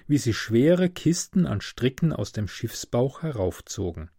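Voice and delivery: ordinary delivery, nothing marked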